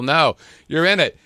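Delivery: hammed-up tough drill-sergeant bark; gung-ho Marine voice. Macho Marines-style boast.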